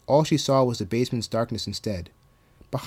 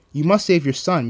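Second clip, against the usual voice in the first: raised, forceful order; commanding; 5 semitones higher, 6 dB louder than usual. A narrator's reading. Counselor urging Marigold to save her son, commanding. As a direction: command it with urgency. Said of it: "Mister Chimicki commanded".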